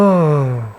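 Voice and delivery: drawn-out sigh, falling in pitch; long sigh. Real, not acted. Sighs after scolding a student.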